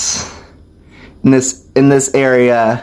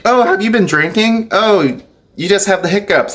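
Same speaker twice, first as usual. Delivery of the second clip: high, lilting mock question; higher voice for someone else's reaction; imitated surprised questioner; 8 semitones higher than usual. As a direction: imitate a curious onlooker, pitched well up. Imitates people asking about hiccups.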